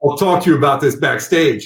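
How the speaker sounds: menacing threat. Retold or imitated. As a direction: play it as a veiled threat, half laughing. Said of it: "it sounded like I said".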